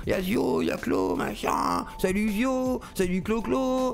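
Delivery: high, slow, jeering impression of the critics; high taunting parody voice; mocking the critics. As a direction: sneer it out as a silly caricature. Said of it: "Les gauchistes et les droitards".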